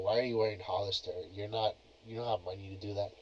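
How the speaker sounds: ordinary delivery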